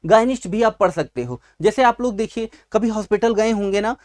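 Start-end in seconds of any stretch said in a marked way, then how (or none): none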